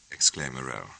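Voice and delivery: plain reading voice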